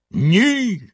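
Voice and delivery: high furious roar; yelling. A narrator's reading. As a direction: shout the refusal. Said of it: "загорлав синя борода". (загорлав)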